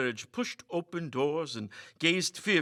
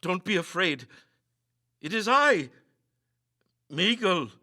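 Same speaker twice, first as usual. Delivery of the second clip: fearful call; 5 semitones higher, 5 dB louder than usual. Acted. Calling reassurance in the dark.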